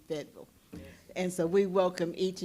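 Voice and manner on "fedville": "Fayetteville" softly contracted to FED-vul; local pronunciation. Shown as a demonstration. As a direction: drop the middle of "Fayetteville", a quiet FED-vul. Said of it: "it would be Fayetteville".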